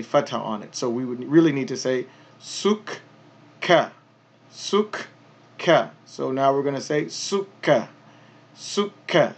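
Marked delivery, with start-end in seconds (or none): 2.4-5.9 "sukka" with a held k, stop then release; doubled k. Shown as a demonstration. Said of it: "stopping and then starting again". 7.1-9.4 "sukka" with a held k, stop then release; doubled k. Shown as a demonstration. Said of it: "stopping and then starting again".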